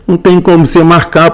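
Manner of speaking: usual speaking voice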